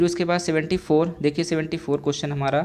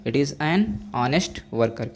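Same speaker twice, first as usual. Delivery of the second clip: very slow, word by word; teacher reading the answer at dictation pace; much slower than usual. Shown as a demonstration. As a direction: spell it out slowly for the class. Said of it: "teacher dictating the answer".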